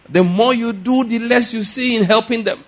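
loud high pulpit voice; preaching. Acted. Pastor preaching to the congregation, loud and high. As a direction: keep thundering the sermon.

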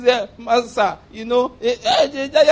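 high, whiny, crying protest; the widow frets to Elijah, mock sobbing; mock whimpering widow. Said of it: "The widow was talking like this".

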